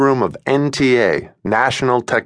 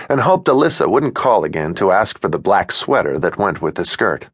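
ordinary delivery

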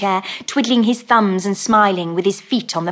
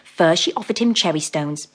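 ordinary delivery

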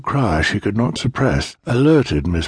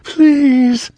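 high, loud desperate plea; screaming girl; 7 dB louder than usual. A narrator's reading. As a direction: scream it, begging. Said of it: "Anne screamed".

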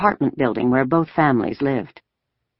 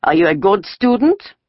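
higher-pitched sneer with a rising end; sneering question; 6 semitones higher, 4 dB louder than usual. A narrator's reading. Soldier questioning a schoolgirl, sneering. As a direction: question the child with a mild sneer. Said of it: "He seemed to be sneering".

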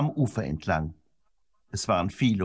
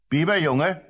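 higher-pitched call of a name, rising; 6 semitones higher, 3 dB louder than usual. A narrator's reading. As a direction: call the boy sharply by name. Medicine man suddenly calls the boy.

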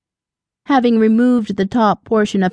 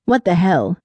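loud mutter. A narrator's reading. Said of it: "muttering loudly".

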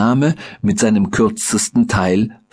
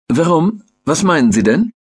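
high, sharp, demanding; sharp question; 8 semitones higher than usual. A narrator's reading. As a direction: snap the question out. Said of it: "fragte der kürzere ... Männer scharf".

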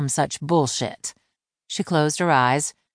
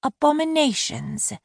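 much higher, slow, echoing his word; wry echo; 11 semitones higher than usual. Acted. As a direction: repeat the insult back, unimpressed. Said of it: "She nodded slowly and repeated".

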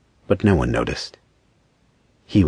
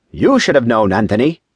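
raised, higher-pitched rebuke; stern reproach; 6 semitones higher, 4 dB louder than usual. A narrator's reading. Angel rebuking Anthony before the council, stern. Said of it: "he said sternly".

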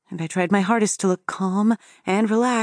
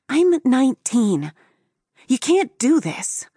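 slow, high-pitched objection; upset protest; 5 semitones higher than usual. A narrator's reading. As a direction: protest slowly with raised pitch. Narrator protests being searched.

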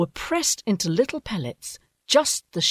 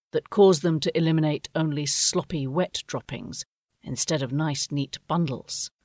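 plain reading voice

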